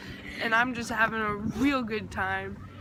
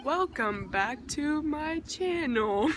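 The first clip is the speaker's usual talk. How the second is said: drawn-out, slow, singsong welcome back to my channel; slow vlogger catchphrase; 3 semitones higher, slower than usual. Pretend. Vlogger greeting her viewers, slow hammed-up catchphrase. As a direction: stretch the welcome like a signature line. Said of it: "This is gonna be my thing now".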